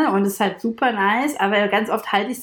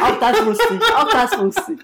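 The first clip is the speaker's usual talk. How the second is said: laughing, a bit louder and higher; 4 semitones higher, 4 dB louder than usual. Real, not acted. Co-host laughing and teasing about predicting everything. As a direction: repeat it through laughter, teasing.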